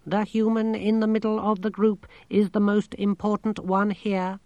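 high, monotone computer voice; talking machine, matter-of-fact. A narrator's reading. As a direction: report it evenly like a machine.

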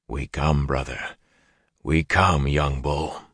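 wolf voices in mind. A narrator's reading. The wolves answering Perrin inside his mind, low and soft. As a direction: speak as wolves calling into his head.

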